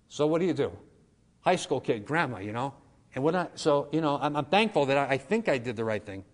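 ordinary talk, nothing marked

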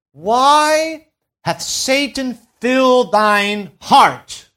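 slow, loud, drawn-out King James reading; preacher intoning the verses; solemn scripture proclamation. Acted. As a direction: let each word ring out.

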